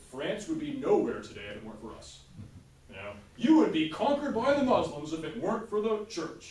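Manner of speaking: high, loud indignant church voice; church scolding. Retold or imitated. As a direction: lecture the French king indignantly. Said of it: "the church would be like".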